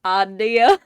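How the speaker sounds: put-on loud accented "Idea", like "ah-ee-uh"; exaggerated accented "Idea", rising at the end; imitated accent slip. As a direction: mimic the slip on "Idea", first vowel an open "ah". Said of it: "I start going".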